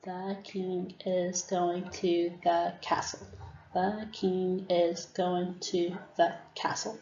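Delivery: slow, soft, each word separated; slow word-by-word counting. Shown as a demonstration. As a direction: count the words out slowly. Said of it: "the students would say".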